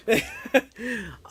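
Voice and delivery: short high laugh. Real, not acted. Streamer amused at himself, short high laugh. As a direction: let out a brief laugh. Laughs after his own joke.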